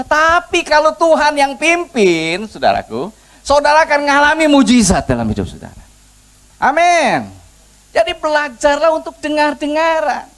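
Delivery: high, rising preacher's exhortation with stretched words; forceful preaching. Real, not acted. Preacher promising mujizat to the congregation, rousing and forceful.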